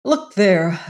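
grieving outcry; despairing grief. A narrator's reading. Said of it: "cried Venters in despairing grief".

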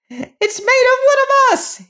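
shrieking creature; dwarf scream. Acted. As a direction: scream in outrage as the chief dwarf. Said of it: "he screamed".

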